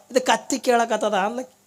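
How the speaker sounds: ordinary delivery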